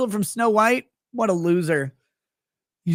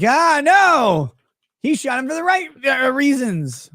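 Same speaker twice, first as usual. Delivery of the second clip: mock defender; 6 semitones higher, 4 dB louder, slower than usual. Mocking fans of the shooter.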